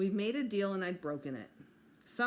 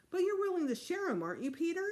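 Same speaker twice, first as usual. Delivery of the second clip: coaxing; 11 semitones higher, 3 dB louder, faster than usual. Acted. Dad coaxing the child.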